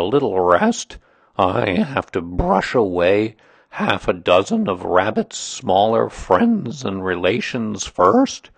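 slow, ponderous grumbling donkey; put-upon complainer with swooping emphasis; gloomy donkey, aggrieved. Acted. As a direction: air the grievance heavily, dragging the words.

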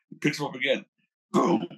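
usual speaking voice

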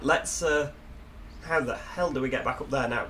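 ordinary delivery

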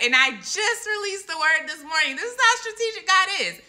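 high-pitched, thrilled exclamation; amazed excitement. Real, not acted. Telling viewers how strategic God is, in high amazed excitement.

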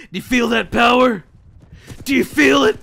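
loud, high taunting; excited taunt. Real, not acted. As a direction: gloat loudly at them. Taunting about power.

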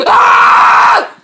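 sudden loud jump-scare yell; startling boo. Pretend. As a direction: shout to spook them. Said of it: "Did I scare you?".

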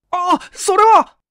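high, loud, slow alarmed cry as the bread is taken; a young man gasping out a protest; high, stretched cry of alarm; startled cry. Acted. Bread snatched from his hand.

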